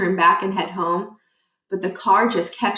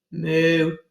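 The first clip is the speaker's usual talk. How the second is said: distant moo; 4 semitones lower than usual. Pretend. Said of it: "a cow mooed".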